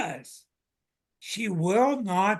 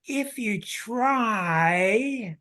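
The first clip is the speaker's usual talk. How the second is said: very slow emphasis; 4 semitones higher, much slower than usual. Real, not acted. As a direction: drag out if you try, slowly.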